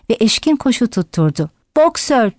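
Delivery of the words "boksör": crying out. A narrator's reading. Yonca chasing the van, crying out to Boxer. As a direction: call the name out, pitched well up. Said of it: "diye bağırdı".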